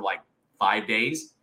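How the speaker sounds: ordinary delivery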